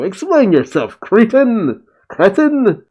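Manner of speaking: high, hammed-up scolding; indignant demand. A narrator's reading. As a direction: demand an answer, pitch climbing on the insult. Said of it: "The Shogun is furious with you".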